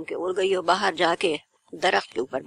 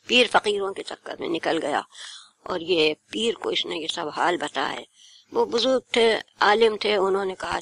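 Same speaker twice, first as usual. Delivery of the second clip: ordinary delivery, nothing marked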